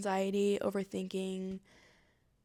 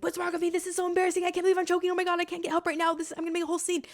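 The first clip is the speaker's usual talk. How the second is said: high, louder rush of anxious thoughts; panicked inner voices; 9 semitones higher, 5 dB louder, faster than usual. Retold or imitated. Thoughts scolding the speaker mid-choking, panicked. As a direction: imitate panicked thoughts taking over, all in one stream. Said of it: "taking over".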